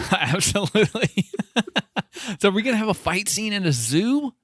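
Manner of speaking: high, laughing excitement. Real, not acted. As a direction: bubble over with laughter as you ask. Laughing excitedly at zoo idea.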